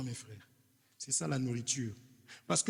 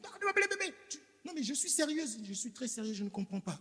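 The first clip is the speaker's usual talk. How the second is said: annoyed wife; 8 semitones higher, faster than usual. Acted. Wife rejecting her husband's sweet talk, higher, exasperated. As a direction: play the fed-up wife refusing the flattery. Acted wife still angry.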